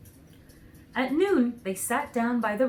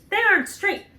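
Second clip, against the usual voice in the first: high, louder Munchkin complaint; the other Munchkin; 12 semitones higher, 8 dB louder than usual. A narrator's reading. A Munchkin objects to the painted ears, high and loud. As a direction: squeak it up like a fussy little man. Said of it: "answered the other".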